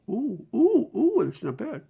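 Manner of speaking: high delighted squeals; pleasantly surprised, squealing; thrilled coos over the makeup; delighted ooh. Real, not acted.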